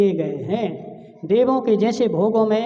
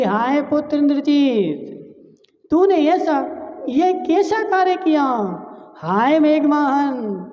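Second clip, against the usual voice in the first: wailing mother's lament; 4 semitones higher than usual. Acted. Mandodari reproaching her sons for leaving, voiced lament. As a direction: cry out to the sons like a grieving mother. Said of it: "रोते रोते क्या बोल रही है".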